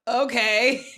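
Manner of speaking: bursting out laughing. Retold or imitated. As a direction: laugh it out in disbelief. Awkward exit after rude dismissal.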